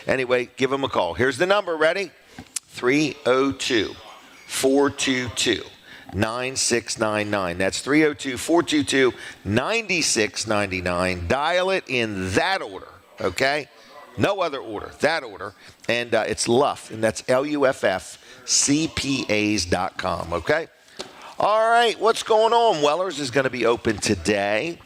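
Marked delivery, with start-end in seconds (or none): 2.7-7.6 slow, spaced-out digits; slow dictation. Real, not acted. Dictating phone number to listeners. 21.4-22.8 high, loud radio-host kickoff; energetic, pitched-up opener; upbeat segue. Real, not acted. Radio host launches the next segment.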